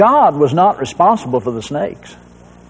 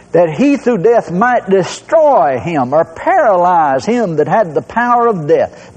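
usual speaking voice

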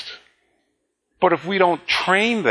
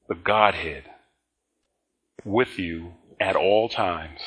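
gentle reassurance; 6 semitones lower, 4 dB quieter than usual. Acted. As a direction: comfort them quietly, closing out. Soft closing reassurance.

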